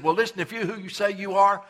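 a mocking thief calling out to Jesus from the cross; mocking jeer. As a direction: sneer it like a jeering thief. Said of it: "had mocked him and said".

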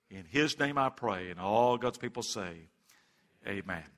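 soft, low pastoral prayer voice. Acted. Pastor praying softly over his congregation before the sermon. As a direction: pray gently over the congregation, unhurried.

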